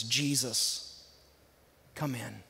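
soft, low whispered-close prayer; a quiet sinner's prayer to Jesus. Shown as a demonstration. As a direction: pray it gently and hushed. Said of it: "simply say something like this".

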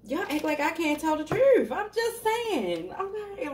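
high-pitched playful self-defence; mock protest. Real, not acted. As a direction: whine it high, stretching the words out. Joking mock protest to laughing viewers.

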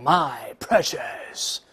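slow whispered Gollum, my precious. Retold or imitated. Teacher imitating Gollum holding the ring, slow greedy whisper. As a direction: breathe it out like Gollum hoarding the ring. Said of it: "很多人贪婪地拿着这个戒指".

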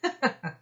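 short high chuckle; a quick amused laugh from the engine; engine's laugh. Acted. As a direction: Edward laughing at Gordon. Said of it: "Edward laughed".

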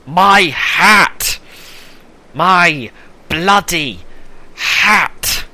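high, loud mock lament; hammed-up outrage at a loss; anguished outcry. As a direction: wail it slowly, word by word. Mourning a lost hat.

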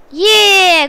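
high, loud cheer; triumphant whoop; celebrating. Real, not acted. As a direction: cheer the win with a long yeah. Celebrating match wins.

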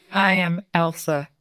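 ordinary delivery, nothing marked

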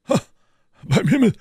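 loud, high cry of greeting; surprised, booming welcome; hearty exclamation. A narrator's reading. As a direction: call it out as friends walk in. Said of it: "rief er aufstehend".

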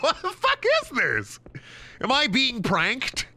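high, incredulous what-the-fuck; baffled squawk of disbelief; stunned disbelief. Retold or imitated. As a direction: react like you think you're being pranked. Retold shock at strange request.

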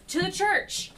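high, loud cry to the group; panicked yell. Acted. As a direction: yell it out in alarm. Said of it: "look panicked and just yell".

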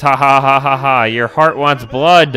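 slow, loud villain taunt; gloating villain. Acted. Villain telling the frightened hero what he feels, gloating. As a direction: relish it like a game villain, with a laugh in the middle.

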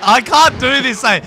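loud, high gamer complaint; exasperated outburst. Real, not acted. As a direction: give up loudly on the car. Losing badly in game.

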